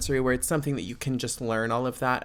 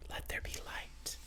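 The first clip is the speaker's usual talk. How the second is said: whisper; 17 dB quieter than usual. Pretend. Joking about the light.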